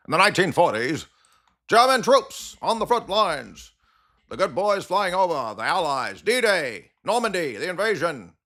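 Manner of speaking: high 1940s newsreel announcer voice; old radio announcer. Retold or imitated. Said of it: "talks like a nineteen forties radio announcer".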